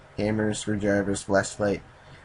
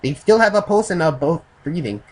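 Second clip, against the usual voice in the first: higher, louder put-on Japanese accent; badly faked Japanese accent, pitched up; Japanese accent attempt; 8 semitones higher, 7 dB louder than usual. Narrator voices the Japanese man rolling his eyes, Japanese accent. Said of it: "I can't do a Japanese accent".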